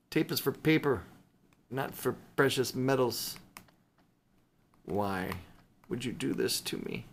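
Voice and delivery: ordinary talk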